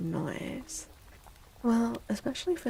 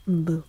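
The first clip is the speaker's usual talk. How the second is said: mock gasp and boo; 4 semitones lower, 6 dB louder than usual. Mock scare after a gasp.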